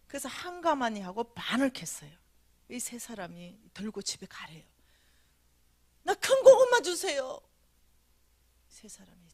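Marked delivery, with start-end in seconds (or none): 6.0-7.4 loud, high and slow begging; loud plea. Retold or imitated. Dreamer begging the three men for big potatoes, loud whine. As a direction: ask for the big one like a greedy child.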